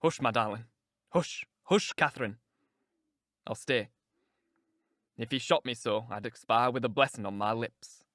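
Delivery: low, tender hushing; calm her gently in a low voice; devoted lover's low murmur; soothing. Acted. Heathcliff soothing frantic Catherine.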